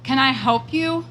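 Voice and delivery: firm, commanding question across the lawn; a put-on bossy tone to warn off a stranger. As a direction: sound like you're in charge here. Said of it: "in my best bossy voice".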